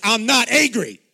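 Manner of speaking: loud, high mock-furious denial; angry outburst. Accused child finally letting the anger out, hammed-up shout. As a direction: blow up comically while denying it. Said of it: "then the anger would come out".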